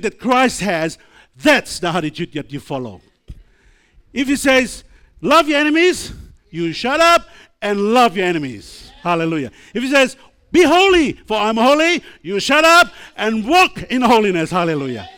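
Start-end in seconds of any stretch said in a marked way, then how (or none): 4.1-8.9 loud, high, driving preacher voice; forceful preaching. Real, not acted. Preacher driving home a command. 9.7-14.5 loud and high, building to a shout; shouted preaching. Real, not acted. Preacher driving home a command.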